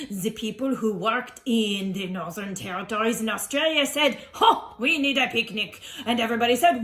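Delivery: put-on theatrical French-accented dinosaur presenter; hammed-up French accent, lively and declaiming. Dinosaur explaining Picnic Day to listeners, jolly French showman.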